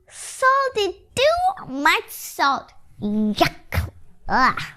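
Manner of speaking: high, slow grossed-out voice; high, slow complaint about salty soup; disgusted. Acted. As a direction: drag it out in disgust.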